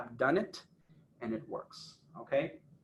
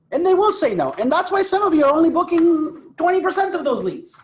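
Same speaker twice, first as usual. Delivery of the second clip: emphatic; 10 semitones higher, 7 dB louder than usual. Real, not acted. Coach stressing failure point.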